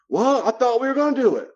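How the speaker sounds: high, loud, aggrieved complaint; whiny boundary buster. Acted. Boundary buster complaining when told no, high and loud. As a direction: sound wronged and entitled.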